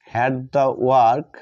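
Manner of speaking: very slow, word by word, as if writing it out; slow dictation. Shown as a demonstration. Teacher writing on the board, dictating slowly to the class. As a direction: draw each word out for the class to copy.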